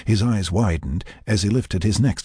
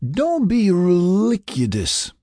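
put-on drunk mumble; 12 semitones higher, much slower than usual. A narrator's reading. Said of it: "she mumbled".

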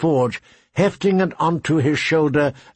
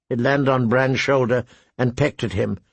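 ordinary delivery

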